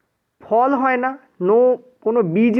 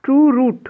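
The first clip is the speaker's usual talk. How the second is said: True punched higher and louder; stress on true; 6 semitones higher, 4 dB louder than usual. Shown as a demonstration. Teacher dictating to students, stressing true. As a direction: hit the word true hard. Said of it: "প্রথম কি".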